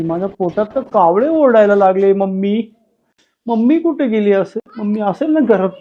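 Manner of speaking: usual speaking voice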